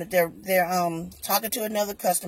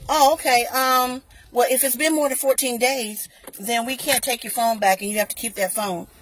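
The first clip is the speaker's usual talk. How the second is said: a light, high, louder voice playing the store clerk; a hint of a chirpy clerk brushing her off; mock store rep; 5 semitones higher, 5 dB louder than usual.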